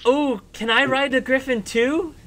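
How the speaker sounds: put-on high, begging little kid; eager child voice. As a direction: plead like an excited child. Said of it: "You hear a little child's voice".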